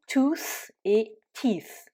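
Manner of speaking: very slow "tooth" with u then "teeth" with i. Shown as a demonstration. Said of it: "le double O se transforme en double E".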